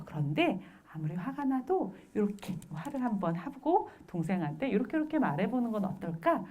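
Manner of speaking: soft, soothing parent voice; acted quiet reassurance, guiding the child along; gentle coaxing. Mother guiding upset child to use words, gently.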